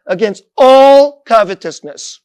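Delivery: loud, stretched-out "all"; stress on ALL. Real, not acted. Preacher warns against every kind of coveting, stress on "all". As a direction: lean hard and long on "all". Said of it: "I want to emphasize that word".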